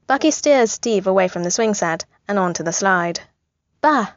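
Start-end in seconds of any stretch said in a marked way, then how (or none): 3.8-4.1 put-on high, emphatic baby "Bah!"; emphatic baby shout. A narrator's reading. Baby Steve atop the slide, emphatic high "Bah!". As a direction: blurt it like a toddler making a point. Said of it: "Steve declares emphatically".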